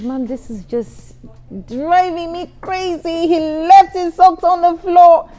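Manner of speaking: high, slow, tearful whining to mom; whiny complaint. Retold or imitated. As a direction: wail about the socks like an upset young bride. Young wife complaining to her mother.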